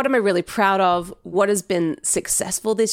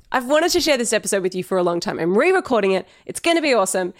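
usual speaking voice

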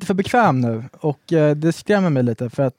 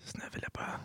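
whisper; 24 dB quieter, faster than usual. Pretend. Mocking bedroom talk.

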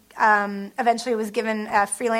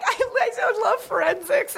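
laughing mock sob; 10 semitones higher, slower than usual. Crying in my room and being like.

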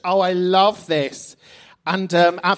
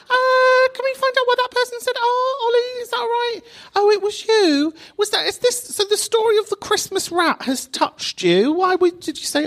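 much higher, fast mock-chatty voice; mock interviewer. Host pretends to quiz the reviewer, high gushing mock voice. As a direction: play a gushing chat-show host.

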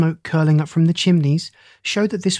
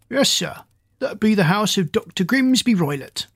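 higher-pitched, lightly voiced country driver; coach driver; 4 semitones higher than usual. A narrator's reading. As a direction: answer as a helpful driver, pitched up a little. The driver answers in character.

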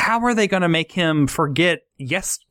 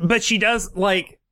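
usual speaking voice

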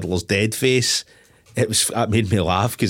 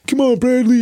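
loud, high mock movie call; imitated urgent call; 6 dB louder than usual. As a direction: call your mate to come, hammed up. Voicing character calling to his mate.

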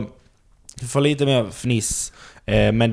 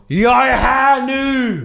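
high, loud, drawn-out yell; shouting; 12 semitones higher, 5 dB louder, much slower than usual. Retold or imitated. Comic shouting through the window at a club audience, shouting. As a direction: holler it long and high to announce an arrival. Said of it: "bankar med båda händerna och skriker".

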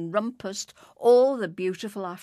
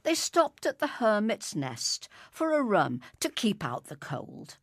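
usual speaking voice

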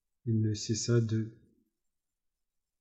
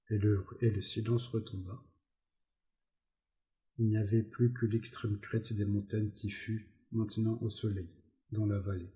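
plain reading voice

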